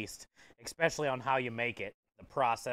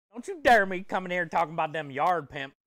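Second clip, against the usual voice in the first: mock Cajun accent; 5 semitones higher, 8 dB louder than usual. Answering a dare with a mock Cajun accent, loud. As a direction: play the Cajun cook, teasing and loud. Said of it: "Cajun accent?".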